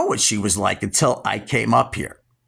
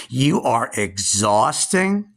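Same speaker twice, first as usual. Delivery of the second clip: usual speaking voice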